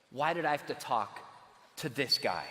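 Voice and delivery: low, regretful inner groan; inner thought. Retold or imitated. Cornered student thinking to himself, low and regretful. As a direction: mutter it to yourself with regret. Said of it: "I know exactly what he was thinking".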